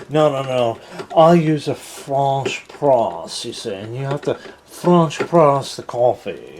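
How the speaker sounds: slow, stretched mock-French coffee snob; hammed-up not-really-French accent; fake French accent. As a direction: put on a bad French accent, fussy and explaining. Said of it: "that's not really a French accent".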